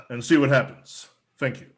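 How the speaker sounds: usual speaking voice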